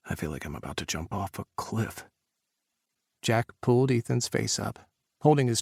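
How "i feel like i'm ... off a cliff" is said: shaken. A narrator's reading. Ethan trembling with fear.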